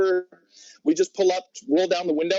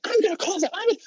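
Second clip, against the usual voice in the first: much higher, panicked imitation of the girlfriend; frantic girlfriend; faster than usual. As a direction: squeak it out like she's losing it. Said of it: "she's, like, losing her mind".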